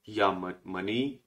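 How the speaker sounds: या मनी said slowly as an example sentence; teacher slowly showing या मनी. Shown as a demonstration. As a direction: model या मनी slowly for learners. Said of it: "तो आप सीधा बोलेंगे".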